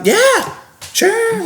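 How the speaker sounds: very high, sing-song yes; lightly voiced chirpy compliance; mock eager agreement. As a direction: squeak it out like an overly eager kid. Mocking his own reply to managers.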